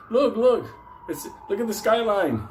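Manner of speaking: high, excited pointing out; mock excitement. Out-of-control driver shows passengers the skyline, excited. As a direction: call out the sights, overexcited.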